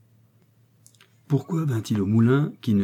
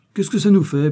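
eager outburst; 9 semitones higher, 8 dB louder than usual. A narrator's reading. Victoire scheming eagerly.

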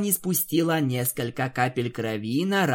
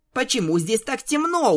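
surprised; 10 semitones higher than usual. Acted. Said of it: "удивилась Пинки".

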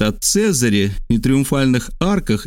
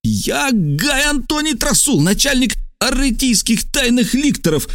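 shouted self-announcement; 12 semitones higher than usual. Acted. Two secret lictor chiefs confront each other, high shouted titles. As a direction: proclaim name and rank at a high pitch.

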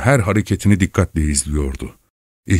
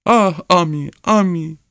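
high, sorrowful moan; lamenting; 10 semitones higher than usual. A narrator's reading. Wife crying for her dead dog Ami, lament. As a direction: mourn in a high woman's voice.

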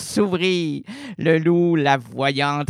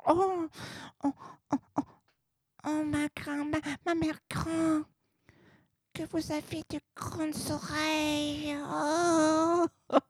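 put-on high, soft whimpering child; frightened little girl; 11 semitones higher, 7 dB quieter than usual. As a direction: quaver it like a scared small girl.

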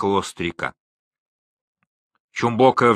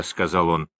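plain reading voice

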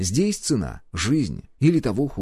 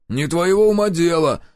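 louder, higher curt rebuff; snapping back; 8 semitones higher, 5 dB louder than usual. A narrator's reading. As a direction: snap that it's none of their business. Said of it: "огрызнулся Каша". (огрызнуться)

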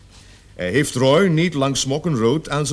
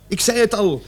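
ordinary talk, nothing marked

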